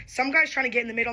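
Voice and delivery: ordinary delivery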